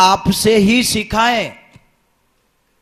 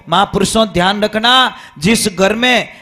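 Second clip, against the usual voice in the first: preaching; 2 semitones higher, 5 dB louder than usual. Acted. Preaching to audience.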